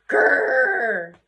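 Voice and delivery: loud, frustrated growling noise; hammed-up "grrr"; mock angry growl. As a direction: growl like a fuming witch. Imitates the angry witch's growl.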